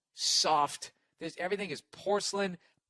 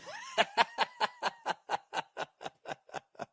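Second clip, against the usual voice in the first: high laugh. Real, not acted. Laughs at own joke.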